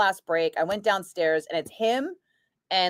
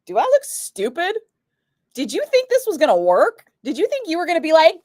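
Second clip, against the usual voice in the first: higher, louder, incredulous pushback; indignant retort; 10 semitones higher, 4 dB louder than usual. Retold or imitated. Retelling how he snapped back at bosses, indignant and loud. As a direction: call out the bosses' lowball offer.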